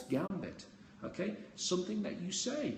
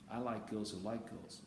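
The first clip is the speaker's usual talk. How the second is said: teasing parting line; 6 semitones lower, 7 dB quieter than usual. Shown as a demonstration. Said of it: "I kind of turn my back and I go".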